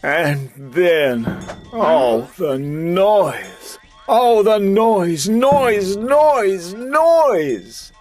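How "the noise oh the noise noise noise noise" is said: ordinary delivery, nothing marked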